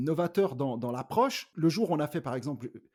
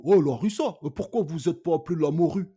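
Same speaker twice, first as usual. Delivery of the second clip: loud, jeering bodyguard voice; a mild mock of a bodyguard taunting his colleague; 2 semitones higher, 7 dB louder than usual. Bodyguard mocks a colleague backstage, loud and jeering. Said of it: "il fait".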